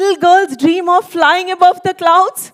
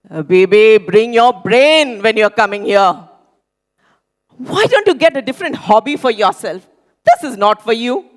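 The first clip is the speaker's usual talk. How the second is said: mocking instructor; 5 semitones lower than usual. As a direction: belittle her like a harsh instructor. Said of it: "insulting comments of the instructors".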